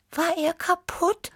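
very high kitten voice, curious; high-pitched inquisitive kitten; Tibi Tiger. A narrator's reading. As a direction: ask it in a tiny high cat voice.

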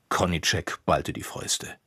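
usual speaking voice

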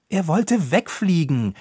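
defensive. Acted. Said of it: "verteidigte sich Roppucher".

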